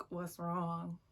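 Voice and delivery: understated, irritated retort; pissed-off echo. Retold or imitated. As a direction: throw the question back, annoyed. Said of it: "I'm just clearly pissed".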